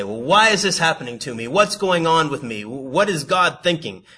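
high, loud put-on bewilderment; mock complaining. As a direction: raise each question like a put-on victim. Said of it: "And when they say".

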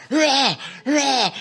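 loud, high mock battle cry; sleepwalker growl. Sleepwalking coworker roaring at roommates, loud mock battle cry. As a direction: belt out a half-asleep war shout. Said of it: "And he's all".

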